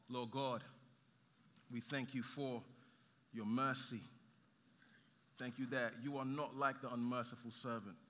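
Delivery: low, measured prayer with long pauses; hushed, reverent pastoral prayer; closing prayer. Real, not acted. Leads congregation in prayer.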